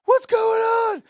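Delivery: high, loud shouted demand; alarmed manager's yell. Retold or imitated. Manager yelling in a retold scene.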